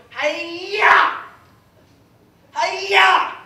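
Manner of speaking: punchy hammed-up martial arts yell; imitated karate yell. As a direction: imitate a little kid's karate shout. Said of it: "she goes in her karate".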